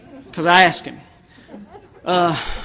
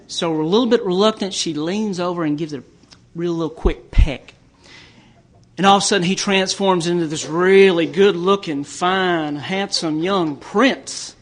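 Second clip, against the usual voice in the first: ordinary talk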